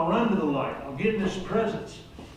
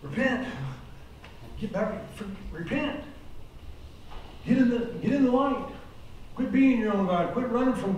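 higher, urgent altar call; pleading call to repent; 5 semitones higher than usual. Acted. Preacher urging the men in the program to repent, higher and urgent.

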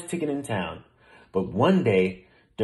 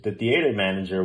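plain reading voice

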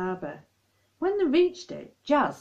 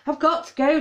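hasty; 8 semitones higher, 6 dB louder than usual. Acted. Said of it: "Charlie said hastily".

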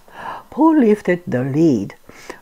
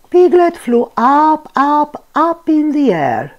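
excited; 8 semitones higher, 3 dB louder than usual. A narrator's reading. Rising excitement in narration.

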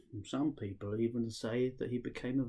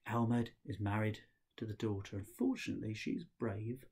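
usual speaking voice

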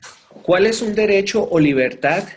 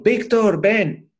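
"Víctor" called out higher and louder with a b sound; parent calling; 7 semitones higher, 3 dB louder, much slower than usual. Retold or imitated. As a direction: call your son to come over. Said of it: "nosotros de papás les diríamos".

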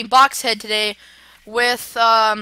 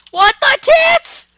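very high, loud, drawn-out outburst; exaggerated disbelief; 13 semitones higher, 8 dB louder, much slower than usual. Real, not acted. Player reacting to a game glitch, loud drawn-out disbelief. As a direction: stretch it out in squealed disbelief.

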